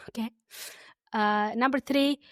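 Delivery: normal talking voice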